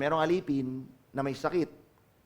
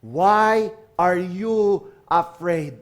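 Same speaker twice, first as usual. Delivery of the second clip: Jesus answering; 6 dB louder, much slower than usual. Acted. Jesus answering the cry for help, slow solemn question. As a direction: drag out the question with weight. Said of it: "Ano unang sagot niya?".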